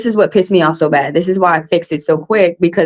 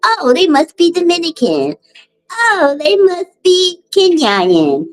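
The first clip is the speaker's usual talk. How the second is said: much higher, put-on airy voice of a clueless stranger; mocking clueless people; 10 semitones higher, slower than usual. Mimicking strangers who guess her nationality, airy high mockery. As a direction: parody someone guessing your origin.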